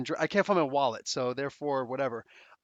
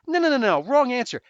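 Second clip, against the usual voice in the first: angry retort; 6 semitones higher, 5 dB louder than usual. Retold or imitated. Angry retold quarrel in car.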